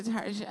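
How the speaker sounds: "Target" as a Frenchified tar-ZHAY; French pronunciation. Retold or imitated. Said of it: "in a French accent".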